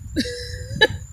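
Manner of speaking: high, soft wheezy laugh; quiet giggle at your own accent; breathy high chuckle. Real, not acted.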